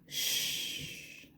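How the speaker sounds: soft breathy shush; hushing shh. Acted. Dad hushing the man who started to shout, softly. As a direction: hush him gently.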